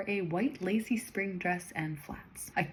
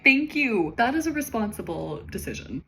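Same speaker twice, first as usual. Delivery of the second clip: emphatic approval; 5 semitones higher, 8 dB louder than usual. Real, not acted. Reader praising Toby.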